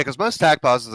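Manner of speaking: ordinary talk, nothing marked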